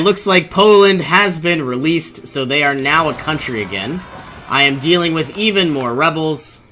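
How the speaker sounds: ordinary talk, nothing marked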